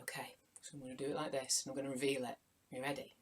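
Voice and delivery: hushed, quick conspiratorial whisper; suspense whisper. Acted. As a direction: draw the kids in with a secret whisper. Building suspense before reveal.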